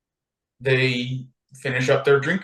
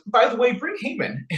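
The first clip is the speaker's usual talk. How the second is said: sly Esther; 11 semitones higher than usual. Retold or imitated. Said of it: "Esther says".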